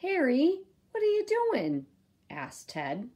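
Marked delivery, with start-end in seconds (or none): 0.0-1.9 high, loud alarmed question; startled high cry. Acted. Ted jolted awake asks Harry, high and loud alarm.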